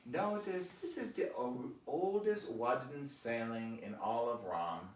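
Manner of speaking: imitating the guide's slow foreign English; stretched, mangled vowels in a mock German accent; German tour guide accent. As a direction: foreign guide pointing out a sight, slowly. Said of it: "like, she goes".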